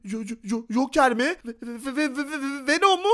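ordinary talk

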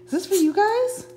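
very high, sing-song pet voice with rising questions. Real, not acted. Owner asks her dogs about a walk in high baby talk.

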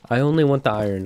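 ordinary delivery

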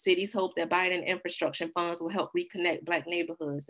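usual speaking voice

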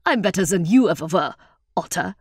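hammed-up affronted boast; mock outrage. A narrator's reading. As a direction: fire back as if insulted, in fun. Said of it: "in mock outrage".

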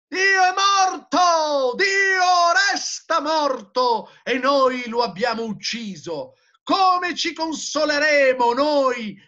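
high, loud drawn-out cry; impassioned proclamation. A narrator's reading. Madman announcing God's death to the crowd, high anguished shout. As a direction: shout it out slowly as a terrible announcement.

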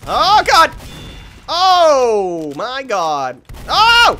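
loud, high cries of shock; sharp rising yells, drawn out; startled yelling. Real, not acted. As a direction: cry out in dismay. Startled in combat.

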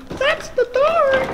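high, drawn-out excited cry; excited exclamation. Real, not acted. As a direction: stretch it out in delight. Spotting the dart, amazed.

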